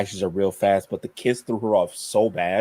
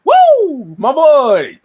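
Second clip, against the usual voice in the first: much higher and louder, a whooping cheer; excited cheer; 12 dB louder, much slower than usual. Real, not acted. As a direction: cheer your horse on at full voice. Her horse bursts out after her.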